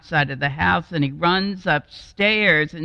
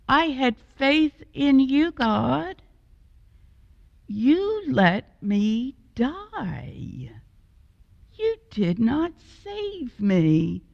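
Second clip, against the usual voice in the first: higher, slow aggrieved questions; indignant complaint; 8 semitones higher, slower than usual. Acted. Drowned man complaining to God at the gates, indignant. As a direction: accuse God of letting you die.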